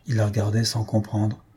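usual speaking voice

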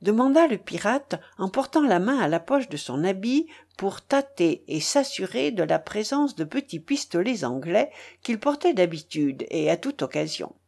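usual speaking voice

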